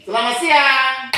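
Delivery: "Selamat pagi" called out high and loud; called greeting. Shown as a demonstration. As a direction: sing out the greeting to the class. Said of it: "Coba ulang". (salam "Selamat pagi" diserukan tinggi dan keras)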